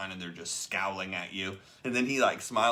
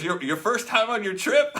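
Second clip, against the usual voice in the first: teasing; 14 semitones higher, 10 dB louder than usual. Retold or imitated. Top earner teasing the novice.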